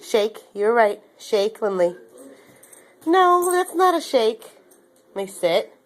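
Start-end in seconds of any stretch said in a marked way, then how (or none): 3.1-4.4 talking to a dog. Real, not acted. Correcting the dog.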